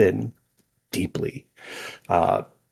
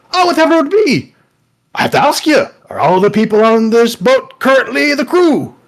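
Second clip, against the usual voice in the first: high-pitched, loud, exuberant boat character; booming hello in a high cartoonish voice; excitable talking boat; 13 semitones higher, 9 dB louder than usual. Newly awakened boat introduces itself to its captain, excitable high voice.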